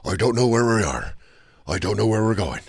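low, hushed mock movie hero; shitty Liam Neeson impression. Host mocking an emotional impressionist's act, bad impression. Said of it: "doing a shitty impression".

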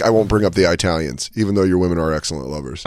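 ordinary delivery

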